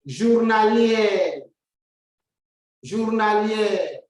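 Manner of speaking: teacher modeling "journalier" slowly; slow French word. Shown as a demonstration. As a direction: draw out "journalier" syllable by syllable. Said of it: "When you go to the next one".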